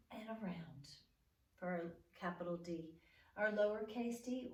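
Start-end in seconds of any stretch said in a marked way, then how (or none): none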